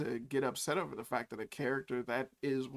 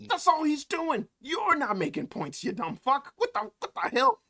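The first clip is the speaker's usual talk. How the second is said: angry shout; 9 semitones higher, 6 dB louder than usual. Real, not acted. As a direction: yell it at him in disbelief. Furious reply to friend's remark.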